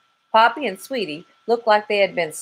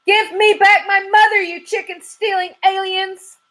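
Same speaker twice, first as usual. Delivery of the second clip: much higher, louder shrill charge; high screaming charge; battle cry; 4 dB louder than usual. Acted. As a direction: rush to the rescue yelling. Chicken attacking barbecue.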